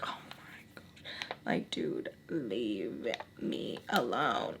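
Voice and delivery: slow, very soft whisper; exasperated whisper. Retold or imitated. Thinking as Sam starts up upstairs, slow exasperated whisper. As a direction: whisper it wearily, drawing out each word. Inner reaction to Sam resuming.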